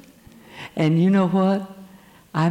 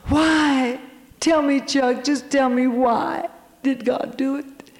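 high, pleading cry of why, not shouted full out; half-reliving her desperate plea to God; retold anguished scream; 7 semitones higher than usual. Wife-to-be pleading with Chuck by the statue, high anguished cry. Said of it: "and I'd scream".

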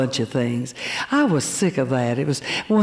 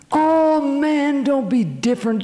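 much higher, mocking peer pressure; a sneering tempter, pitched way up; whiny tempter voice; 9 semitones higher, slower than usual. The other voice scoffs at a teen being different, whiny. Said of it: "another voice saying".

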